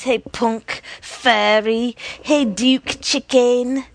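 loud, drawn-out name-calling; jeering. A narrator's reading. Gang mocking Duke for backing out, jeering. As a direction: hurl each insult loudly at him.

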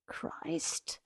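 curse under the breath. Acted. Man cursing aside, not for her ears, whispered. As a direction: let it slip out, not meant to be heard. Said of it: "out of the side of his mouth came a fast spat curse".

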